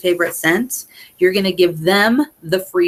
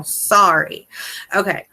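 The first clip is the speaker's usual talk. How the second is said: normal talking voice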